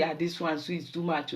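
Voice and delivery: low, soft dismayed murmur; a daughter quietly aghast at her father. Retold or imitated. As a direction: sigh it low under your breath. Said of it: "I say".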